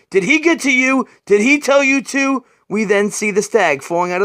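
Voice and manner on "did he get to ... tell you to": rising urgency. Acted. Batman's rising suspicion.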